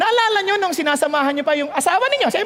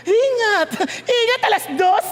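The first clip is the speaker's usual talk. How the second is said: high, exaggerated mock goodbye; put-on lover's parting call; hypocritical farewell; 7 semitones higher, slower than usual. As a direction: overdo the caring send-off, half laughing. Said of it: "Napaka-hipokrito".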